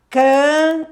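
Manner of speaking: loud high syllable Can, held; syllable stressed. Shown as a demonstration. Teacher showing M at syllable end, loud. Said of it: "final da sílaba".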